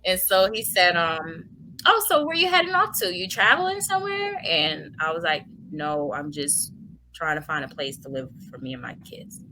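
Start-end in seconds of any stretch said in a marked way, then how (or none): 1.8-4.4 high, lilting curious questions; breezy driver. Retold or imitated. Cab driver asks where passenger is heading, breezy and high. Driver's small talk, acted. 5.7-9.4 low, soft, fading to near whisper; subdued reply. Retold or imitated. Tearful mother tells driver her plight, subdued and quiet.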